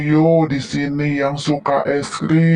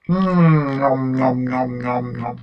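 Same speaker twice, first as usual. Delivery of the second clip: nom nom chomping. Pretend. Pretends to eat ice cream.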